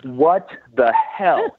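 very slow, drawn-out astonishment; dumbfounded, each word stretched out; stunned disbelief. Retold or imitated. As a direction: replay the shock word by word. Acts own shock at seeing boat.

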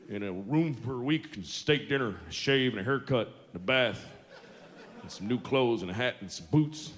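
lightly voiced gruff cowboy rattling off orders; a hint of a trail-worn cowboy listing everything he wants; a touch of a cowboy at the bar; cowboy ordering.

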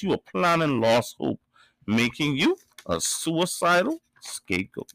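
measured, rhythmic poetry recital; spoken word poem. Acted. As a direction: deliver each line like a verse on stage.

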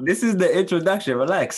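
high, loud laughing scolding; teasing, laughing. Real, not acted. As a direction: rib your friend at full volume. Teasing friend to calm down.

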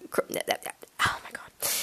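unvoiced, slow, hushed aside; muttered oh my God; whispered aside. Real, not acted. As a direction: whisper it under your breath. Aside whisper while reading.

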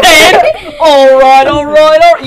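mocking southern accent. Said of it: "can't say all right in a southern accent".

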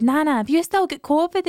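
put-on high little boy question; child asking. Mother voicing her son asking his nana, high and innocent. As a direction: ask it innocent like a small child.

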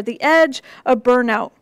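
ordinary talk

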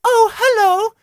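a high, hammed-up romantic heroine voice; dramatic heroine. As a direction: play the girl of his dreams. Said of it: "dramatic reading".

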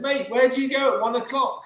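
high, exasperated demand; indignant question. Retold or imitated. Fed-up lumberjack demands an answer from his mate, high and indignant. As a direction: pitch it up like a fed-up workmate.

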